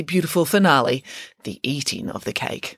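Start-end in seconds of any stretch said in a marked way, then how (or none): 1.4-2.8 breathy hushed awe; hushed reverence. A narrator's reading. Narrator hushing over the cake finale, whispered awe. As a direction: hush it like a sacred moment.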